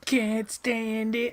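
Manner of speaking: slow, high, soft put-on voice; a high drawn-out mock whine; mock character voice.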